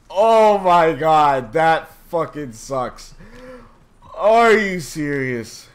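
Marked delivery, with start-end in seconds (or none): none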